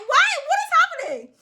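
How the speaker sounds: high shrieking laugh through the words. Retold or imitated. Coworkers cracking up at the man's glasses, shrieking with laughter. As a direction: squeal it out between laughs. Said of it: "he looked over at us".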